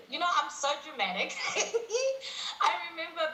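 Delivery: high, giggling self-mockery; laughing at herself. Real, not acted. Guest laughs while telling the host her breakdown story, giggling.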